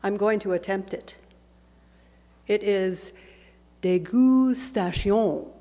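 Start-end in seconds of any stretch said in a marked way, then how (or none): 2.5-5.5 dégustation said slowly in a hammed-up French accent, day-goo-sta-see-on; dégustation slowly, ending on a falling tune, in playful French; outrageous French accent. Shown as a demonstration. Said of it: "in my outrageous French accent".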